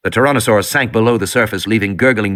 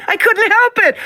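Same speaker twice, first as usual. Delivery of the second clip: miserable shriek. A narrator's reading. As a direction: wail it like a frightened little girl. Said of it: "Lex shrieked miserably".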